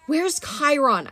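urgent shout, drawn out; Percy shouting for help. Acted. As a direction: call out desperately for Chiron. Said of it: "I shouted".

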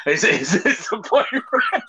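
high, slow words through laughter; laughing. Real, not acted. Friend joking that the boyfriend is the nightmare, laughing through it. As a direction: struggle to get it out while laughing. Cracking up at the joke.

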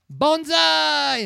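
high, loud "banzai" yell; imitated triumphant yell; yelled battle cry. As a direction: holler it out, stretching the last syllable. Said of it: "Daniel yells".

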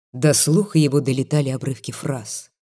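ordinary delivery, nothing marked

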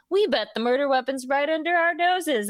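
lightly voiced confident police; clueless cops. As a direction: play dim detectives sure of themselves. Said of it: "they were like".

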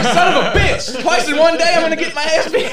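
high, loud comic despair, half laughing; retold exasperated outburst. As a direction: wail at your own rotten luck. Retold panic, alone facing a mob.

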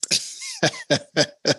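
real burst of laughter. Real, not acted. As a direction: break into a high laugh. Reacts to blunt request.

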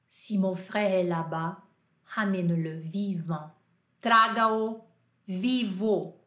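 low, slow, dramatic French; deliberate movie-hero delivery; war film line. Acted. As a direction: draw out each phrase like a war-film order. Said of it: "Isso aqui é frase de filme".

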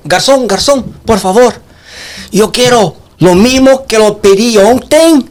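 a Brazilian faking Spanish in a restaurant; hammed-up broken Spanish accent; bad Spanish accent. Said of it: "Que a merda de espanhol que tô hablando acá".

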